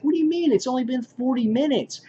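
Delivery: mildly puzzled, pitch up as if thrown; a touch of baffled surprise; confused disbelief. Retold or imitated. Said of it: "he's like".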